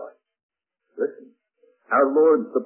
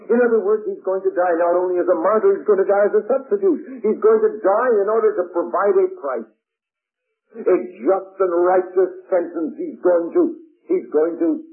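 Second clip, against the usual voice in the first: higher, louder preaching climb; fervent higher-pitched preaching; rising preaching fervor; 8 semitones higher, 4 dB louder than usual. Real, not acted. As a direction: drive it home from the pulpit. Preacher builds to climax.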